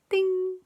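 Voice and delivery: chimed high ding; high doorbell ding. Acted. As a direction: ring it like a doorbell. Doorbell button pressed.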